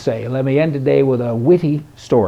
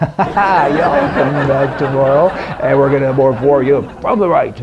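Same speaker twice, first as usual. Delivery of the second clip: laughing sign-off; 4 dB louder than usual. Real, not acted. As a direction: wrap it up while laughing. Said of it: "sign-off, laughing".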